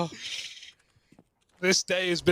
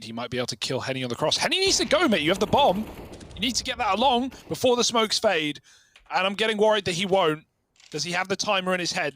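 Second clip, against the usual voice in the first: play-by-play. Acted. Live casting of the round.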